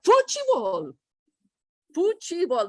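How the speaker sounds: high-pitched "Futebol" said "foo-chee-bow"; three syllables in "Futebol", T as "ch", final L like "oo"; Brazilian Portuguese pronunciation. Acted. Teacher showing how Brazilians say it, "Futebol" as high "foo-chee-bow". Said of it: "So what do they say?".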